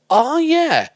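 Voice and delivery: high, drawn-out oh yeah; mild recognition. Acted. Imagined viewer recalling an old show, high acted oh yeah. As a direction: sing out a casual recognition, acted. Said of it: "won't just go".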